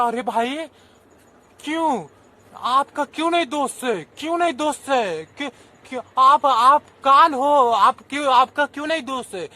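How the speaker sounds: heated confrontation. Acted. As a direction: challenge Death to admit blame. Snake charmer confronts Death.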